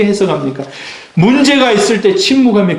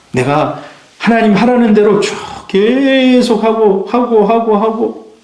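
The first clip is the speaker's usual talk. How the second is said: dragged-out repetition; 5 semitones higher, slower than usual. Acted. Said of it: "repetition dragged".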